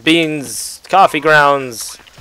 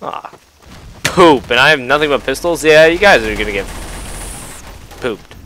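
ordinary delivery

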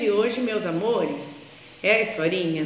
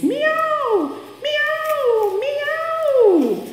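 very high sweet meows; imitated cat meow, very high; much slower than usual. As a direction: meow like a happy kitten. Cat arrives meowing.

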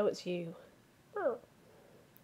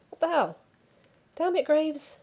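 annoyed outburst; 7 semitones higher, 5 dB louder than usual. Acted. Cat jumped on her back.

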